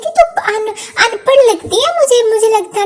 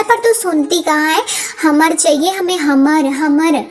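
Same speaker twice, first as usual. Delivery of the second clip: a lower, grumbling sidekick voice; low-voiced partner; 7 semitones lower than usual. Partner nagging Kamla about the screwdriver, lowered voice.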